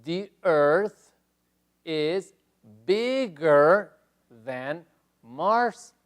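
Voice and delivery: teacher reading the slide question slowly; slow model reading. Shown as a demonstration. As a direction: spell it out slowly for the class. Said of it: "Quedaría".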